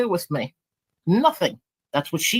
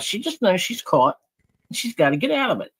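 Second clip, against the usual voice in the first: normal talking voice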